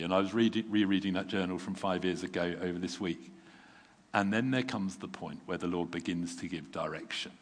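ordinary talk

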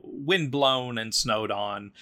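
ordinary delivery

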